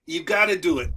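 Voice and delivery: ordinary talk, nothing marked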